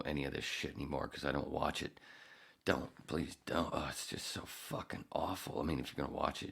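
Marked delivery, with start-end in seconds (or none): none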